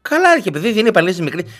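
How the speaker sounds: ordinary talk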